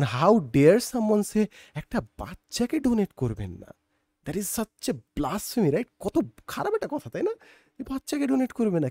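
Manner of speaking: high-pitched scandalised objection; mock outrage. Voicing society's shocked objection. (নকল ক্ষোভে আঁতকে ওঠা গলা)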